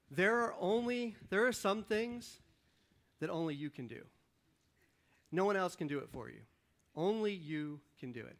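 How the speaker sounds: usual speaking voice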